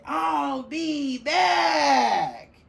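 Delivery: very slow, high, loud menacing vow; villain's threat. Acted. Throw-up germ vowing to return from the wastebasket. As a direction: drag out the threat like a movie villain. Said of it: "the germ that makes you throw up said".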